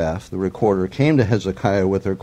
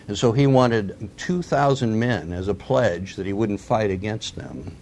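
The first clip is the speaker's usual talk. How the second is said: ordinary delivery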